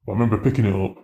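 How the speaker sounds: ordinary talk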